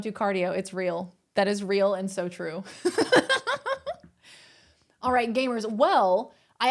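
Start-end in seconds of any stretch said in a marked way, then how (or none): 2.7-3.9 a bright high burst of laughter. Real, not acted. Laughing at chat's cardio joke, a high burst of laughter.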